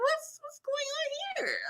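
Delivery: high, hushed disbelief at the screen; shocked squeak. Retold or imitated. Viewer gasping at a steamy TV scene, shocked squeak.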